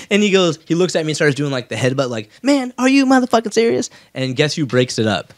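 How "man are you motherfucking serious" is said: high, incredulous mock yell; outraged retort. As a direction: act outraged at the request. Diaz mock headbutt, outraged.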